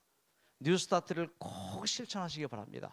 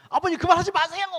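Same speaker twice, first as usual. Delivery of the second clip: high, loud retort to a father; mock protesting yell; 13 semitones higher, 11 dB louder, faster than usual. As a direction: cry out in mock exasperation. Acted protest to late father.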